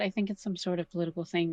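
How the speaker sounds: usual speaking voice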